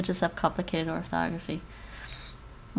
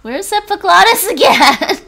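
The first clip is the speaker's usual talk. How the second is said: amused exasperation; 12 semitones higher, 14 dB louder than usual. Real, not acted. Laughing frustrated question.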